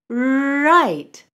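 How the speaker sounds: "right" with a long buzzing R at the start, high and loud; drawn-out R. Shown as a demonstration. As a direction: hold the R of "right" before the vowel. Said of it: "say the word right like this".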